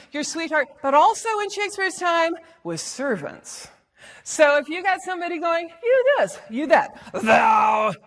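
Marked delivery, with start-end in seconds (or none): 5.8-7.9 hammed-up jabbing put-down, "thou" hit loud; mock jeer building to a loud final word; dissing insult. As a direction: fling it as a contemptuous insult. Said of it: "ooh, that's a diss".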